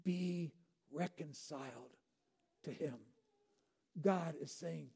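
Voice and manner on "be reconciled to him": soft plea. Acted. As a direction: gently beg them to come back. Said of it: "is begging you now".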